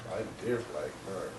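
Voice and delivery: dropped to a soft, inward repeat; quiet repeat. Real, not acted. To himself after the loss, a soft repeat. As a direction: say it again under your breath.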